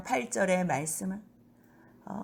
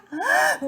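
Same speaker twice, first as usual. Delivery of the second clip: joyful gasp. Acted. Matthew's joy at being called.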